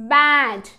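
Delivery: "బ్యాట్" loud, long vowel, falling; pronunciation demo. Shown as a demonstration. Teacher modelling the blended word, "బ్యాట్" loud and drawn out. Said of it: "ఎలా పలుకుతుందో చూద్దాము".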